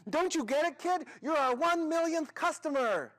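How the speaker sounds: high, loud, exuberant announcement; booming high-pitched prize announcement; excited shouting. Acted. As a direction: shout the big news at the kid. Manager shouts the news.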